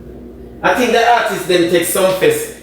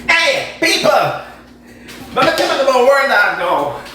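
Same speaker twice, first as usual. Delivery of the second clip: announcer call; 7 semitones higher than usual. Acted. Calling out to the audience.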